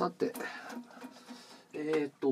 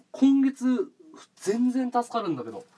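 excited; 8 semitones higher, slower than usual. Real, not acted. Streamer thrilled by 100k gift.

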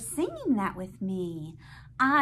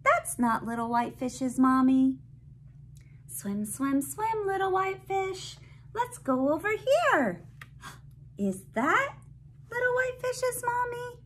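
put-on reading to a toddler; 9 semitones higher, 4 dB louder than usual. Reading picture book to child.

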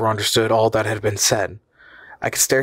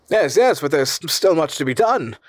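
eager cry; 6 semitones higher than usual. A narrator's reading. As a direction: burst in eagerly, tripping slightly. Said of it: "cried M. Fredrickson".

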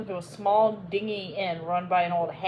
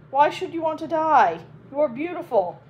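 flattering Phoebus; 8 semitones higher, 6 dB louder than usual. Acted.

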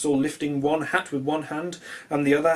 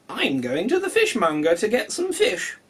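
cat voice; 6 semitones higher, 3 dB louder than usual. Acted.

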